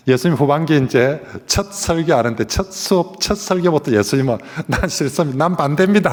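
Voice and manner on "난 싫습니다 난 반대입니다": Peter's indignant refusal, rising; rising indignant protest; mock protest. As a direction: act out a stubborn disciple refusing. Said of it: "하고 막 그렇게 난리를 칩니다".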